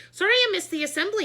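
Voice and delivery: high, loud and fast, rushing in; hurried apology. A narrator's reading. School board president bursting in late, high and hurried. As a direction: blurt the apology on the run.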